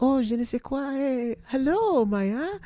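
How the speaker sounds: high, soft mock French accent. [name] from Canada greets Maya, mock French accent. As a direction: put on a lilting French accent, softly. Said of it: "with her French accent".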